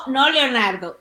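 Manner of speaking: ordinary talk, nothing marked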